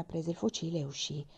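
plain reading voice

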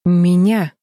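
forced out between clenched teeth; through gritted teeth. A narrator's reading. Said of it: "процедила Флис сквозь сжатые зубы".

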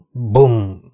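sudden loud spoken gunshot; imitated bang. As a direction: boom it out, cutting the sentence off. Imitated gunshot interrupts speech. (hirtelen durranás utánzása)

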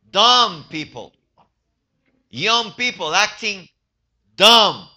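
loud, slow, punched words; emphatic rebuke. Real, not acted. Preacher to young people about their foolishness, emphatic rebuke. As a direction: hammer each phrase out loudly and slowly.

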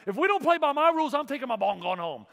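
high, loud playground whine; sulky little boy. Retold or imitated. A little boy on the court quitting in a huff. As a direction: stomp off like a kid taking his ball home. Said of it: "like the little boy on the basketball field".

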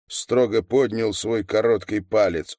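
usual speaking voice

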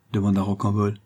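ordinary delivery, nothing marked

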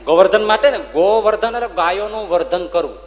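high, loud preaching declaration; emphatic proclaiming. Real, not acted. Preacher proclaiming the meaning of Govardhan to listeners, loud and high. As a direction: ring it out to the crowd.

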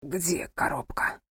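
slow, faint whisper; weak effortful whisper. A narrator's reading. Wounded husband musters strength to ask, weak whisper. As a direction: whisper with what strength is left. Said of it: "нашёл в себе силы задать важный вопрос".

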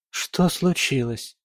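ordinary delivery, nothing marked

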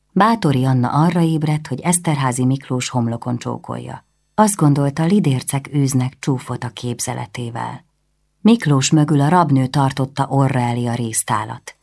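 ordinary delivery

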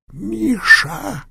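high gurgling cry; dying gurgle. Acted. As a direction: gurgle the name weakly. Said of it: "пробулькал едва слышный голос".

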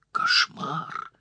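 breathless blurted gasp; out of breath. Acted. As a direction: blurt it out panting from the run. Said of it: "запыхавшись, выпалила Анжела".

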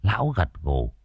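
usual speaking voice

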